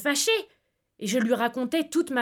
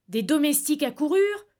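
ordinary delivery

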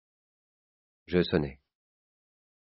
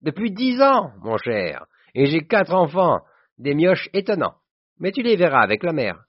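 high, loud, proud announcement; exuberant cry; 11 semitones higher, 4 dB louder than usual. A narrator's reading. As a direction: cry out your family news with gusto. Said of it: "Il cria". (s'écrie fièrement, voix haute et forte)

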